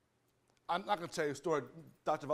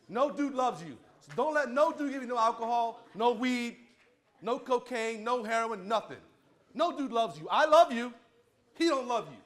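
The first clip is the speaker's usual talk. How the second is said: high, loud, passionate insistence; real heated outburst; lathered up; 6 semitones higher, 5 dB louder than usual. Real, not acted. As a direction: hammer each refusal, pitched up and forceful. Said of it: "I'm getting all lathered up".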